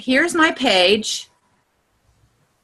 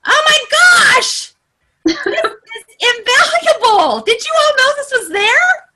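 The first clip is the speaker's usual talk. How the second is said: high, louder excited outburst; voice going up; 11 semitones higher, 4 dB louder than usual. Real, not acted. Trainer amazed by an invaluable Facebook feature, voice going up. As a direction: let the voice shoot up in amazed delight. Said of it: "My voice goes up".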